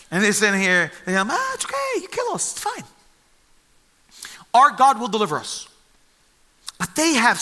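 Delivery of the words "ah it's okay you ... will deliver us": much higher, airy and nonchalant; melodramatic falsetto. Retold or imitated. Three men breezily answering the furious king, hammed-up falsetto. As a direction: squeak out a carefree shrug at death. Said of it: "their response to him is so melodramatic".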